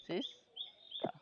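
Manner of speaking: "সিস্টার" softly and slowly, sis, pause, tar; syllable by syllable. Shown as a demonstration. Teacher repeating for students, "সিস্টার" slowly split sis-tar. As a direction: say "সিস্টার" in two separated beats. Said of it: "এই একবারে এটা উচ্চারণ".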